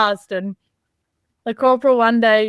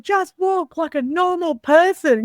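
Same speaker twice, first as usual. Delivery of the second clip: slow, higher-pitched mock exasperation; exasperated instructor; 7 semitones higher, slower than usual. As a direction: imitate an instructor fed up with a marching recruit. Instructor correcting marching, retold.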